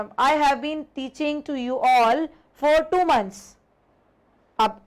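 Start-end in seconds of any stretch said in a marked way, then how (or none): 0.0-3.4 each word laid out very slowly; deliberate teacher dictation pace; slow example read. Shown as a demonstration. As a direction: read the model sentence slowly for the class. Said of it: "teacher reads example sentence".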